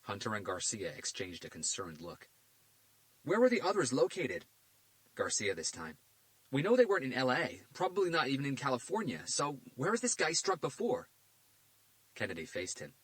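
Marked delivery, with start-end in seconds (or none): 3.2-11.1 a pushy detective, voice pitched higher and louder; Garcia's voice. A narrator's reading. Detective Garcia demanding answers from the FBI director, pushy.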